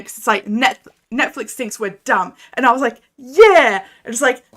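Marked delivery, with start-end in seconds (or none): 3.2-3.8 loud, emphatic "Yeah" with a falling tune. Retold or imitated. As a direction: bark out "Yeah" in full agreement. Voicing own agreement.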